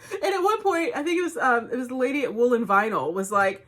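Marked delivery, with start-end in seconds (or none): none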